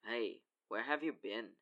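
low, slow accusing question; accusatory. A narrator's reading. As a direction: quietly demand an explanation. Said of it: "at the accusatory tone".

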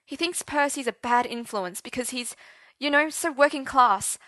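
high, rushed panic; frantic worried teen; panicked. Acted. Arthur panicking about his father.

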